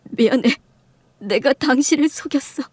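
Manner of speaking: acted, lightly sobbing apology; tearful confession. Husband confessing to his wife, mildly tearful. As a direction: confess through mild tears. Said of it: "남편이 목을 놓아 우는".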